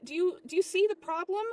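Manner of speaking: "do you see the problem" high and loud; exasperated question. Acted. Worker asks manager "do you see the problem", high and exasperated. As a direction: pitch the whole of "do you see the problem" up in frustration.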